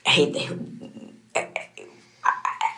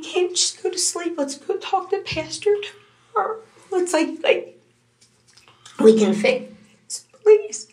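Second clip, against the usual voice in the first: pleading, her voice climbing much higher; desperate plea; 14 semitones higher than usual. Retold or imitated. Mother retelling how she pleaded with her son, voice rising high. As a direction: beg him with rising distress.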